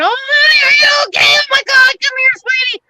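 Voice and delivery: high, loud, hammed-up fussing over a hurt child; mock doting parent. Dad mocking a fussing parent, high and loud. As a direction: overdo the worried mommy voice, way up high. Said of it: "I didn't sit there".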